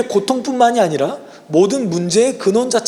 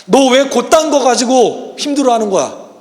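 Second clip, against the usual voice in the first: mock scolding; 6 semitones higher, 5 dB louder than usual. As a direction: play the harsh Jesus he never is, scolding hard. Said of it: "예수님 우리를 절대 책망하지 않으세요".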